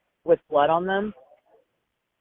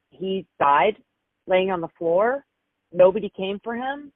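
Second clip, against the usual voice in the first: ordinary delivery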